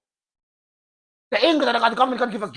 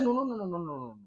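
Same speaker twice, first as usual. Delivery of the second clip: God's soft no; 8 semitones lower, 14 dB quieter, faster than usual. Acted. God refuses the protesters.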